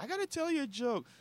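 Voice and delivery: high-pitched, softly slurred tipsy woman; squeaky mock-drunk come-on. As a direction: imitate a drunk woman in falsetto. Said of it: "I'm like, she's drunk".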